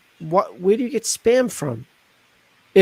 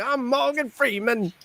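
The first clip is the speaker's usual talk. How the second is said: higher, slow, softer Irish-Scottish lilt; Irish or Scottish accent; 6 semitones higher, 6 dB quieter, slower than usual. Acted. Joking Morgan Freeman intro in a higher, softer Irish or Scottish accent. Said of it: "like, Irish or Scottish".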